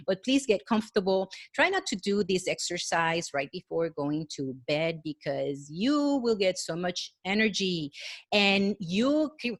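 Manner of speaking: usual speaking voice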